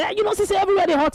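fast, high mock protest; retold shouting back. Husband complaining of heat to his wife, mock shouting back. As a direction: rattle off a high-pitched excuse. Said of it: "himself dey shout back".

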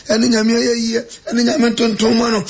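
ordinary talk, nothing marked

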